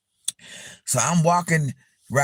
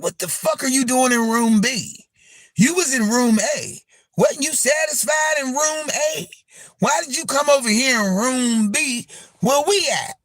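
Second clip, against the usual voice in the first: mock confrontation; 7 semitones higher than usual. Imitating a confrontation.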